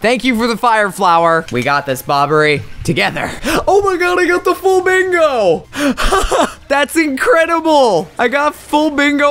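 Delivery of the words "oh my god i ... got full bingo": thrilled shout. Real, not acted. Gets full bingo mid boss fight.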